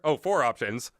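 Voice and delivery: usual speaking voice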